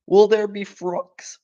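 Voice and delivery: high, slow little cub voice; wide-eyed cub asking; frightened little fox; small fox voice. Acted. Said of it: "asked one of the small foxes".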